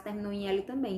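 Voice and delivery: ordinary talk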